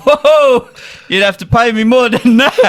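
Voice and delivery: loud, laughing mock recoil; a hammed-up jokey brush-off; laughing retort. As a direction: pull back laughing at the offer. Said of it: "I was like".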